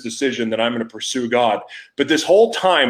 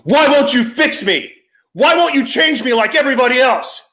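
anguished scream, much higher and louder; a desperate high yell at heaven; screaming at God; 5 dB louder than usual. Retold or imitated. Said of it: "I'm screaming at God".

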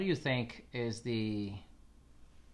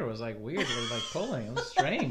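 laughing excuse; 3 dB louder than usual. Real, not acted. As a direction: laugh it off, pitch rising. Laughing off the face.

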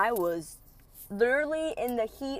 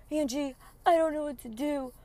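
higher, helpless mock whine; pitiful ex; 7 semitones higher than usual. Ex pleading helplessly over his sick dog, mock pitiful. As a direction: play the sad victim. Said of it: "he's like".

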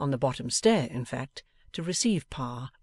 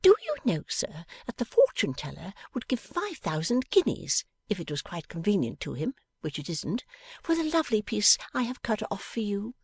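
put-on higher playful young woman; 9 semitones higher than usual. A narrator's reading. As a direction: tease him brightly. Bella voiced.

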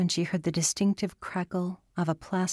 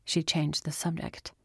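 plain reading voice

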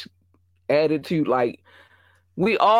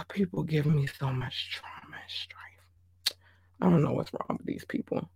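weary sigh; 9 dB quieter than usual. Real, not acted. Host worn out by rude guests, sighing whisper. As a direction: let it deflate into a tired whisper. Weary complaint after rant.

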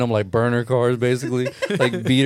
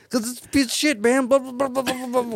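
high, mumbled, half-swallowed whine; imitating a grumbler you can barely make out; squeaky, trailing-off mock complaint; mumbling whiny Don; 12 semitones higher than usual. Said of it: "he was, like, barely audible".

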